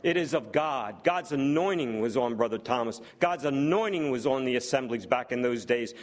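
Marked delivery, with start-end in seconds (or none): none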